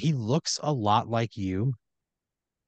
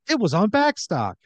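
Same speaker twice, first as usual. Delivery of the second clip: high, loud put-on character voice; Kevin Heaven; 10 semitones higher, 6 dB louder than usual. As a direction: pipe up with an excuse, pitched way up. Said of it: "And Kevin said".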